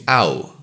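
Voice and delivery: au gliding from a to u; diphthong demo. Shown as a demonstration. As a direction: slide slowly from a into u. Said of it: "you move from a to u".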